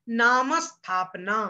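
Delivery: नाम स्थापना slowly, the म joined to the स cluster; teacher models नामस्थापना clearly and slowly; careful slow demo without any i before स्थापना; slow model pronunciation. Shown as a demonstration. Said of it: "इसको कैसे पढ़ना है?".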